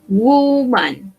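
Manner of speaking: "woman" opened with a long oo; teacher's drawn-out "woman", first vowel U; U sound in woman. Shown as a demonstration. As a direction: stretch "woman" into WOO-man. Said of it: "la pronunciación es con U".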